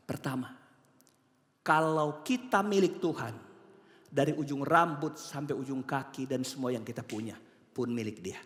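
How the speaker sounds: ordinary delivery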